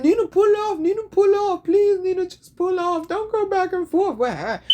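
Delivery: mock her pleading in a high whiny voice; imitating a scared, begging sister.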